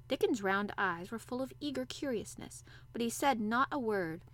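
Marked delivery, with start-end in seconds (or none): none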